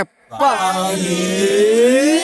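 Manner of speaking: slow drawn-out feeble plea for water; dying gasp. Pretend. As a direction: croak it like a dying old man. Dying uncle asks for water. (મરતા કાકાનો ધીમો અવાજ)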